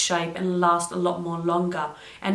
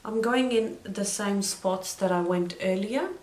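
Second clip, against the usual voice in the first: usual speaking voice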